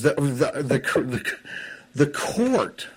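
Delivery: stumbling over the words; stammering, repeating the word; stuttering. Real, not acted. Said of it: "I'm stuttering here".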